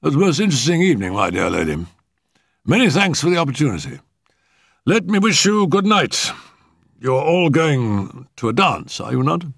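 high-pitched, formal gentleman's voice; high, courteous Sir Allington figure; courtly old uncle. A narrator's reading. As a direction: take gracious leave in a high character voice.

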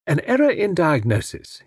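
ordinary delivery, nothing marked

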